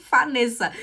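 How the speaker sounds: "Vanessa" said loudly as "Fanessa"; German reading. Retold or imitated. Mother rejecting her husband's German version, loud F pronunciation. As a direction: hit an F at the start of "Vanessa". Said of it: "ele leu".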